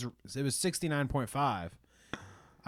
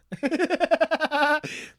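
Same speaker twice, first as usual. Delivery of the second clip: high, loud cackling laugh; shouted laugh; 6 dB louder than usual. Real, not acted. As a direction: burst into a shrieking laugh. Laughing at squeak tweets.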